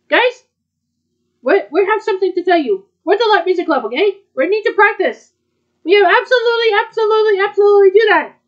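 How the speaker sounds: high, loud pep rally to the group; rallying announcement. Acted. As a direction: fire everyone up to practice. Rallying the club to practice.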